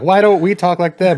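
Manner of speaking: high, put-on kid voice; kid with dumb accent. Kid back from school asking parents, high mock voice. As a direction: pitch it up as a puzzled kid. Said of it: "like, a dumb accent".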